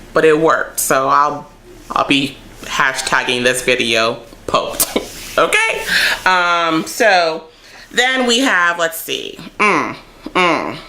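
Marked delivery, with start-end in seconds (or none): 5.4-5.8 laughing, high, rising okay. Real, not acted. Vlogger laughing at her own hashtag joke, high rising okay.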